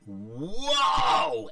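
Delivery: loud, long swooping exclamation; amazed whoa. Retold or imitated. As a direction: let astonishment swell and rise. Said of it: "I went".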